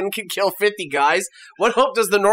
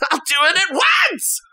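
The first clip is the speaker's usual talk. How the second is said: high-pitched eager screech; Jockin impression; 11 semitones higher than usual. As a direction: shriek like a servile toady. Answers as Jockin the servant.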